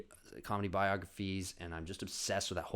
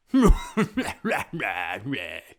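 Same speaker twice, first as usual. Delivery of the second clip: villain laugh; 11 dB louder than usual. Acted. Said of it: "classic impression of a, uh, cat bad guy".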